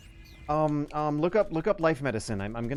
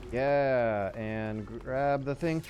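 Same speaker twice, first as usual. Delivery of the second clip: ordinary talk, nothing marked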